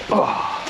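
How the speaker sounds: a breathy, fed-up ugh; voiceless ugh of disgust. Real, not acted. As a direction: huff it out in annoyance. Grumbles about river discharge rules.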